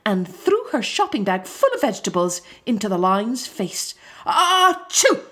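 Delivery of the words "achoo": high, loud achoo; loud sneeze. A narrator's reading. Lion sneezes after vegetables hit his face, loud sneeze. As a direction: sneeze it out big. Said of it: "sneezed the lion".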